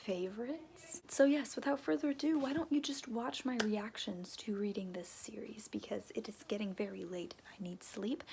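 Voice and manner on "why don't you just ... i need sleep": soft late-night whisper, breath only. Real, not acted. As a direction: keep it hushed so nobody wakes. Late-night video, quiet delivery.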